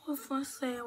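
normal talking voice

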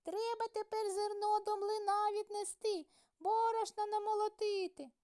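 very high squeaky mouse voices; squeaking in unison. A narrator's reading. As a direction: squeak it together like tiny mice. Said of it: "запищали в один голос".

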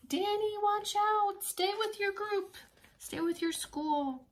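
high, slow, faraway call; calling warning. Acted. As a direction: call out to Danny from a distance. Calling warning to lost Danny.

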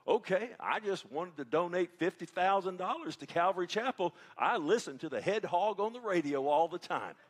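high, loud put-on Texas accent. Caller offering a big donation to the church, Texas accent. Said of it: "using that Texas accent".

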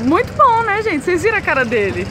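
excited. Real, not acted. Teasing friend who tasted betel.

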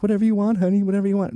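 high, meek pushover voice, pitched well up; beta male. Retold or imitated. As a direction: simper like a spineless husband eager to please. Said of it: "the beta male is like".

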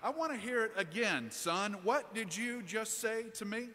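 father demanding. Retold or imitated. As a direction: insist he repeat it, rising up.

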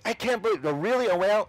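hammed-up disbelief; mock amazement. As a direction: play the stunned onlooker. People amazed at whale timing.

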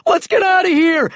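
high shout to flee; high-pitched alarm; panicked shout. A narrator's reading. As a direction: cry out in a panic to run. Panicked crowd fleeing.

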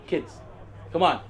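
ordinary talk

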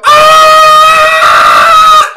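high, loud drawn-out mocking scream; mock shriek while walking away. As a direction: scream back to mock her. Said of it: "So I start going".

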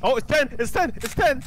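high, loud panicked yells; panicked shouting. Real, not acted. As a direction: shout in panic as the hunt starts. Ghost hunt starts, panic.

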